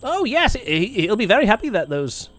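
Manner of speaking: bouncy, halting kobold speech; friendly kobold. Acted. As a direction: welcome them as a chatty little kobold. Game master plays kobold.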